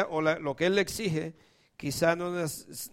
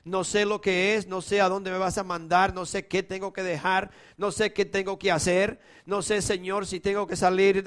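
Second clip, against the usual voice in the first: louder, higher impassioned plea; rising preacher's prayer; fervent prayer; 4 semitones higher, 4 dB louder than usual. Acted. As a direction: pour out a confession of surrender. Prayer rises to fervor.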